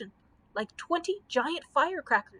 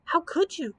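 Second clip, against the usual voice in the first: sorrowful; 7 semitones higher than usual. A narrator's reading.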